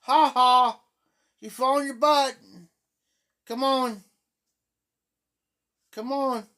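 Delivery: loud, high, upbeat encouragement; cheerful coaxing. Shown as a demonstration. Great-aunt cheering the fallen toddler, bright and loud. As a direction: cheer the little one back onto her feet. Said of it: "I laugh about it".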